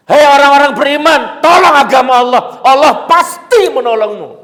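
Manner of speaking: loud, high, ringing proclamation; proclaimed verse. Acted. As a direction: boom the verse out like a preacher. Quoting a Quran verse.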